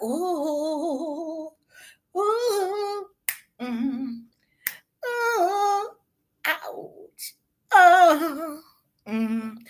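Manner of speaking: high pained oohs and ouches; mock wincing. Reacting to her slamming his head, mock wincing. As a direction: wince at every hit you picture. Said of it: "I was like".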